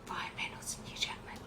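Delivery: soft whispered aside. Real, not acted. Host explains the initials to co-host, whispered aside.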